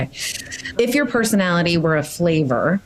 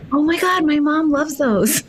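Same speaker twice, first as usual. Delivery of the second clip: excited; 11 semitones higher than usual. Real, not acted. Delighted reaction to Peeps.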